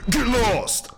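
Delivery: high-pitched holler; mock yell at a stranger. Host sending off a stranger at the door, high holler.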